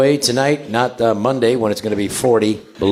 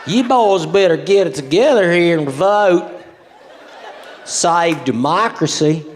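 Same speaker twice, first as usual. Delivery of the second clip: mock southern accent; 4 semitones higher than usual. Kamala pandering down south, mock southern accent. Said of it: "she does different accents".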